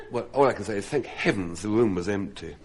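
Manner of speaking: normal talking voice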